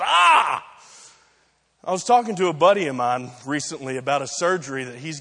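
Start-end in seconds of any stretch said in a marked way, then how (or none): 0.0-0.6 short high, loud "ah!"; quick imitated yell of pain; imitated scream. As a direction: let out a mock scream, pitched up. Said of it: "screaming, just".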